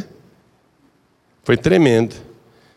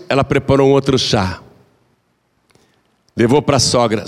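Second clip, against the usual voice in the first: ordinary delivery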